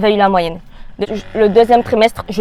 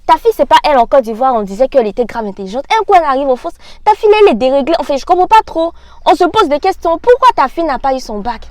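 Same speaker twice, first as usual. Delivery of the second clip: higher, louder, incredulous questioning; indignant relatives; 5 semitones higher, 4 dB louder than usual. Retold or imitated. Relatives grilling a parent about her daughter's bac, indignant. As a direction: act out scandalized gossip about someone's daughter.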